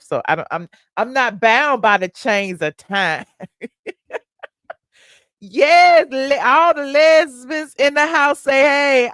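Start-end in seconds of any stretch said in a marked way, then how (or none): 5.4-9.1 louder, higher crowd call; rally the room, loud; hype call. Real, not acted. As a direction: hostess hyping up the chat.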